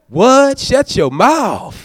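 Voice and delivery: high, loud stunned gasp; mock disbelief. Imagined pastor reacting to early worshippers, stunned. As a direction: act floored by the news.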